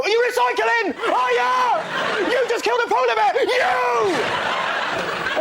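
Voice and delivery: very high, frantic mock shriek; throat-grabbing accusation. Independent grabs reader by throat, high accusing shriek. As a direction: scream it like a crazed eco-zealot. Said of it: "it's like it's grabbed you by the throat".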